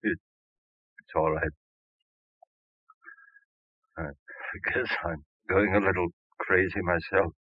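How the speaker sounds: drained. Acted. Husband drained after breakdown.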